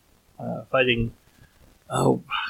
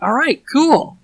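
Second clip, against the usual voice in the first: excited; 13 semitones higher, 5 dB louder, slower than usual. Retold or imitated. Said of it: "I'm like".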